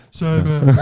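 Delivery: imitation deaf voice on the count; imitating deaf speech; mock deaf voice. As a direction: put on a deaf voice, half laughing. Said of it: "I'll do deaf voice".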